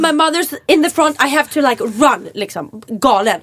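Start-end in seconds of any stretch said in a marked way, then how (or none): 0.0-2.3 insistent plea. Retold or imitated. Retold insistent plea to man.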